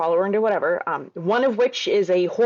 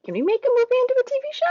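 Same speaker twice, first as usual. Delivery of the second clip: mock writer voice; faster than usual. Acting out the show's writers.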